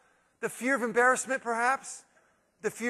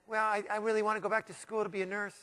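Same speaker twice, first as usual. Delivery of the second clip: meek; 8 dB quieter than usual. Retold or imitated. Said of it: "woman kind of meekly said".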